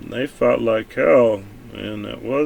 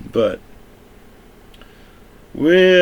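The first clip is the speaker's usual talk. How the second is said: ordinary delivery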